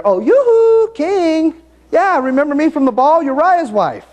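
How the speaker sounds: high, loud, coy falsetto calling out to the king; flirty high-pitched "yoo-hoo" from the rooftop; flirty Bathsheba. Retold or imitated. Preacher voices Bathsheba calling David.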